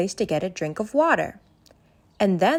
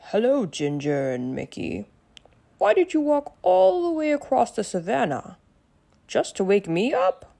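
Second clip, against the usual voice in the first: grumpy but polite joking lion; a just-woken lion teasing his visitors; Leo the lion; 4 semitones higher than usual. Acted. Narrator voices Leo the lion.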